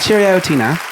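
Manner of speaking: high mock British cheerio; lightly done British accent; Michael's British accent. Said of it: "I can't do Michael's accent as well as he does".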